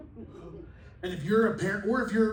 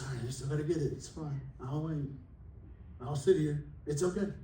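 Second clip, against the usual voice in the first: softer, quieter, calm reassurance; patient and gentle; 3 semitones lower, 6 dB quieter than usual. Retold or imitated. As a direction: soothe them as a patient God would. God answers patiently while we wait.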